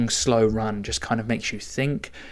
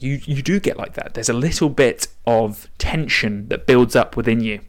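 usual speaking voice

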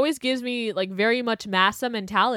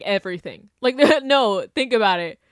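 normal talking voice

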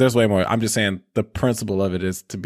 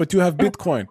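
mock Russian accent; 6 semitones higher than usual. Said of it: "So he's like".

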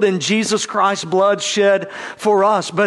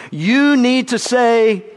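firm parent; 6 semitones higher, 4 dB louder, slower than usual. Acted. Parent correcting a child.

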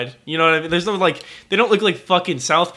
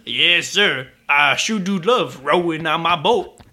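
mock old Southern servant; slower than usual. Parodying a Song of the South character.

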